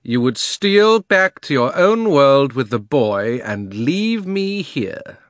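slow, loud, drawn-out accusation; imperious witch. A narrator's reading. Witch accusing Uncle Andrew of deserting her, slow imperious outrage. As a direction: stretch the words in high, regal outrage. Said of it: "she said".

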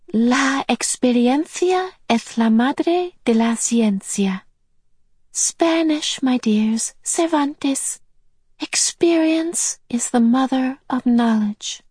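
put-on high, breathless whispered old lady; whispery high voice. Mrs Who quotes Cervantes.

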